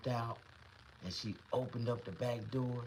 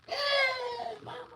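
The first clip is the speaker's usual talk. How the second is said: high, loud wailing cry; a little boy bawling for his mother; mock sobbing wail, very high and loud; crying for mama; 7 dB louder, much slower than usual.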